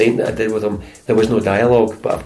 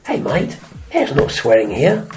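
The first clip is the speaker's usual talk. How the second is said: higher-pitched indignant Australian director; Australian director objecting; 4 semitones higher, slower than usual. Retold or imitated. Director scolding an actor for swearing, indignant Australian. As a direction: jump in to object, voice raised in pitch. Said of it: "he came to life and he jumped up".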